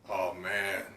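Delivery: ordinary talk, nothing marked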